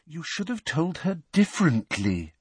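patronising, unruffled explanation; maddeningly patient. A narrator's reading. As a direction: correct with exaggerated patience. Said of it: "that maddeningly patient air".

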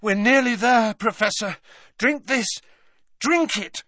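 urgent coaxing. A narrator's reading. Harry coaxing Dumbledore to drink.

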